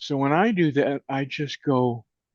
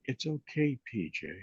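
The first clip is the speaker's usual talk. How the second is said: put-on comforting inner child; 10 dB quieter than usual. Shown as a demonstration. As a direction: speak to a scared four-year-old, softly. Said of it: "And I just say".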